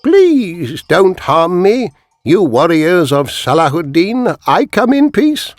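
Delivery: put-on very slow, high pleading old voice; disguised spy playing a frightened old shepherd, slow and high; frail old man. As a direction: beg the warriors for mercy. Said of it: "He was an old man".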